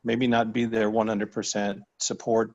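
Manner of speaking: usual speaking voice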